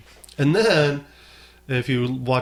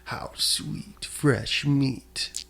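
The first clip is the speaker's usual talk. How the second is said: hushed menacing villain line; creepy villain voice; 5 dB quieter, much slower than usual. Retold or imitated. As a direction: savor it like a predator. Quoting a menacing character.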